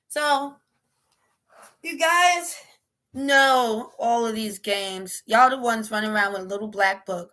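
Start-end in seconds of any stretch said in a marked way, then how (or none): none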